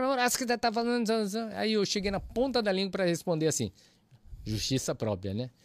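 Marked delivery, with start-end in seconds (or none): 0.0-1.5 high mock scolding, imitating the pastor; pastor's scolding. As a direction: mimic the pastor nagging, pitched up. (imitando o pastor bronqueando, voz aguda)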